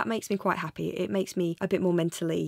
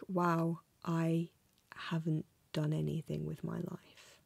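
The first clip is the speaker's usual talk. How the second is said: softer, slower, breathed out in dismay; dismayed whisper; 2 semitones lower, 7 dB quieter, slower than usual. Retold or imitated. Vlogger worrying about turning twenty-five, whispered dismay. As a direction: let it fade into a hush. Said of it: "it's more like".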